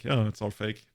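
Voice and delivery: ordinary talk, nothing marked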